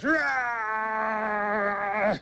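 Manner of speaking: a sudden high shouted roar to scare; short high shouted growl; mock roar. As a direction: bark a growl at the toll man. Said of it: "Blocker leans over and goes".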